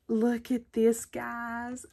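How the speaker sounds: gasp, then an eager look at this; gasp of delight. Real, not acted. Crafter showing viewers a cute find, delighted gasp. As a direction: gasp and show it off.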